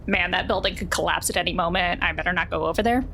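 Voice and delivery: normal talking voice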